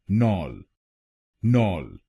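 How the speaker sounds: नॉल with an open ɔ, nɔl; second syllable alone. Shown as a demonstration. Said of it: "दूसरे शब्दांश को बोलिए".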